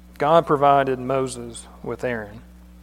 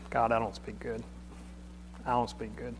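soft, sheepish mumble; timid Moses; 4 semitones lower, 11 dB quieter than usual. Retold or imitated. Moses telling God he can't speak, quiet and sheepish. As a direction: shrink away, ashamed of how you talk. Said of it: "Moses goes".

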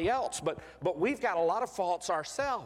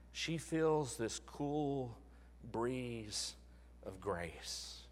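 hushed fading; 6 semitones lower, 9 dB quieter, much slower than usual. Acted. Preacher dramatizes grace arriving.

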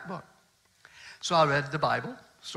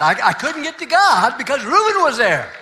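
loud, high, laughing through it; exasperated laugh; 8 semitones higher, 9 dB louder than usual. Real, not acted. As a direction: laugh at how absurd it was. Laughing at his own frustration.

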